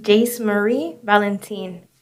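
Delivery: name given slowly and distinctly. Real, not acted. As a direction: say your name word by word, unhurried. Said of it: "just a little bit slower".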